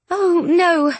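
high, demure little protest. A narrator's reading. Jane answering Rochester's apology, demurely. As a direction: gently wave the apology away. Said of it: "Jane said demurely".

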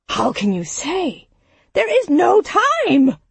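Siggeir cuts in, angry and rising high; a sharp, high angry outburst at the end. Acted. Siggeir snaps at a grieving father in anger. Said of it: "Siggeir's voice was loud with anger".

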